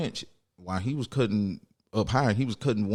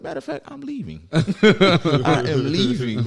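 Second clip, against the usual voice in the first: laughing huff; 6 semitones higher, 5 dB louder than usual. Acted. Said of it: "I, you know what?".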